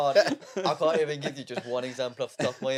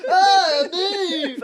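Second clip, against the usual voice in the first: high, loud shriek of protest; high loud yelled no; brother yelling; 13 semitones higher, 8 dB louder than usual. Retold or imitated. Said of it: "And then O's going".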